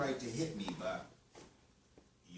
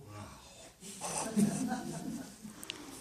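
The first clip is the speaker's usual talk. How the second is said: breathy amazed laugh; 10 semitones higher than usual. Acted. Customer amazed by burger.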